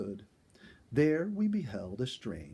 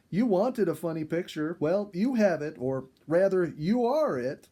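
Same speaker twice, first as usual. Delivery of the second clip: teasing; 7 semitones higher, 8 dB louder than usual. A narrator's reading. Jenny teasing the narrator.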